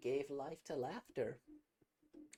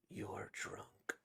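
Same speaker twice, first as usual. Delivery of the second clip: whisper; 8 dB quieter than usual. Acted.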